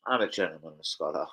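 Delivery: said low and soft into the mic to catch the take. Shown as a demonstration. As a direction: drop the voice low and soft for the retake. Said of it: "I like that".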